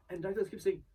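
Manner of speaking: ordinary talk, nothing marked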